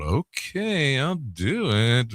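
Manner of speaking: soft, drawn-out mock agreement; doubtful compliance. Young host giving in to his manager, doubtful agreement. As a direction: agree slowly, not quite convinced.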